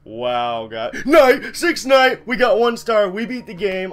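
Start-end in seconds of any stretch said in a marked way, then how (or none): 1.1-3.9 high, loud victory yell; ecstatic shout. Real, not acted. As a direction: shout with joy at the win. Beating the game.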